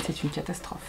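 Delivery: usual speaking voice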